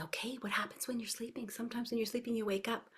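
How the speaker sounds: hushed wondering voice for toddlers; very soft, as if everybody is asleep; animated teacher voice. Shown as a demonstration. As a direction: draw small children in with a near-whisper. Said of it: "So this is my animated voice".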